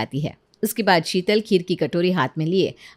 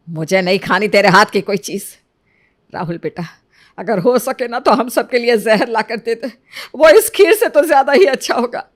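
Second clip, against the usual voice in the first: louder bitter rebuke rising at the end; angry scolding; 6 dB louder than usual. Acted. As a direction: spit out the refusal in disgust. Mother-in-law rejects new bride's kheer. (गुस्से में ताना मारती सास)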